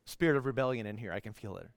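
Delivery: low, hushed mock suspicion; mock-ominous aside. Preacher teasing a reluctant congregation, mock-ominous low aside. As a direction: drop very low and soft, playfully ominous.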